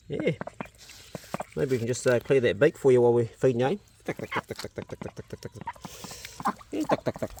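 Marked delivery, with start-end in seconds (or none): 4.0-7.3 soft whisper, slowing at the end; whispered coaxing. Real, not acted. Farmer calming his duck to clear its beak, whispering. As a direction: hush the duck with whispered calls.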